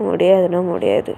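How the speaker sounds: low, firm no from a brother; flat refusal. Acted. Brother refusing his pleading sister, low and firm. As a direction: shut her down.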